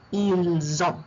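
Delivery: Ils ont as "eel; correct liaison. Shown as a demonstration. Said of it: "The T is silent".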